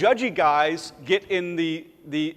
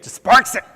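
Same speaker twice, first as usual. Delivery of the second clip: sparks said much higher and louder with a rise; stress on sparks; 6 semitones higher, 10 dB louder than usual. Shown as a demonstration. Preacher playfully savoring the word sparks. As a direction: pop sparks up high and loud. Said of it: "That didn't bring it".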